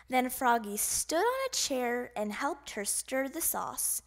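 plain reading voice

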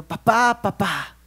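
grito agudo de niño pequeño que imita; llama a papá como el menor, agudo; hermanito imitando. Retold or imitated. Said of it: "imitando a su hermano mayor". (imitación ligera de niño pequeño)

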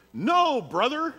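high, loud, slow drawn-out plea; Scott stepping in. Retold or imitated. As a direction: stretch it out like the little friend stepping in. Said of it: "And Scott goes".

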